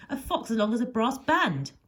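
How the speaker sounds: a milder howl carried through the phrase. A narrator's reading. Foxhound calling the other hounds, howling. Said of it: "he howls".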